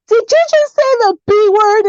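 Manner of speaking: high, scandalized question; shocked mother. Retold or imitated. Mother confronting daughter about a swear word, mock shock. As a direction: imitate an appalled mom checking what she heard.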